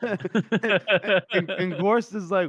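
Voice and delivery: ordinary delivery, nothing marked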